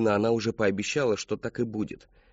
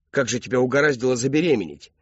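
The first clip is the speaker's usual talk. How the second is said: loud, raised question; furious disbelief; 3 semitones higher, 6 dB louder than usual. A narrator's reading. Father challenging pregnant daughter, loud and angry. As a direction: demand loudly how it happened.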